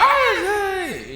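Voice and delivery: high, loud imitation of a crying outburst; mock crying yell. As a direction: wail out like someone bursting into tears. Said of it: "Yelling out, crying".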